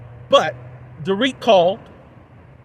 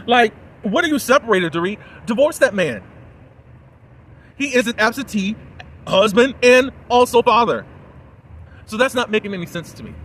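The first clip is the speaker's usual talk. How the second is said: higher, emphatic exasperation; real indignation; 6 semitones higher, 5 dB louder than usual. Real, not acted. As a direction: scold an absent couple in disbelief. Indignant at an absent husband.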